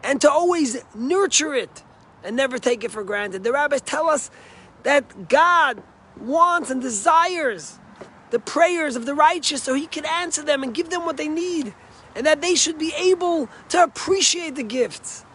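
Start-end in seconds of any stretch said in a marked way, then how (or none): 3.4-15.2 raised, emphatic sermon voice; preaching. Acted. Rabbi preaching to his congregation, raised and emphatic.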